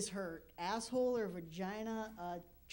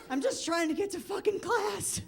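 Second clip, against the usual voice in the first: laughing through it; 9 semitones higher, 6 dB louder than usual. Retold or imitated. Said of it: "And I'm like".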